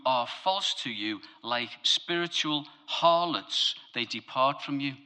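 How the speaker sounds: psalm read with gravity; solemn scripture reading. Acted.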